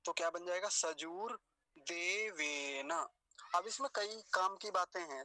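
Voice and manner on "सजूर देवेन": सजूर देवेन very slowly, long oo and long e; slow model recitation of सजूर देवेन, vowels held; careful long vowels. Shown as a demonstration. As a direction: stretch each syllable of सजूर देवेन for learners. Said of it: "बड़े ऊ की मात्रा को ध्यान से बोलेंगे".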